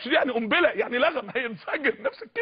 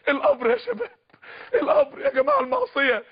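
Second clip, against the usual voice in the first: much higher, urgent plea; high pleading cry; 12 semitones higher than usual. Real, not acted. Preacher warning of the grave. (نداء عالٍ متوسل)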